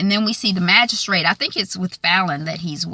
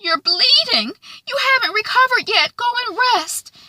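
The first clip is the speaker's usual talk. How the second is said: hammed-up high-pitched concern; Quigley quote. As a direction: pitch it way up, mock-motherly. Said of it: "Madame Quigley says".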